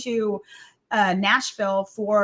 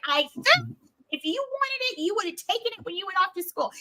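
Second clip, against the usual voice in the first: high, dismissive mock mom; dismissive mother; 8 semitones higher, faster than usual. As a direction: brush off the son in a lifted voice. Mother voiced in story.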